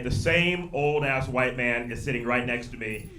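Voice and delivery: normal talking voice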